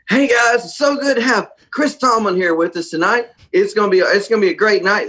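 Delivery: high-pitched hammed-up show introduction; mock emcee hyping a tiny crowd; hype-man host. Acting host hyping six-kid crowd.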